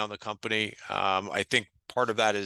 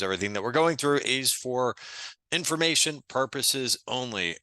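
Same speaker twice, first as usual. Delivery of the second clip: measured restatement, with a pause before "information"; deliberate disclaimer; 2 semitones higher than usual. Real, not acted. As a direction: spell out the disclaimer for emphasis. Same disclaimer repeated for emphasis.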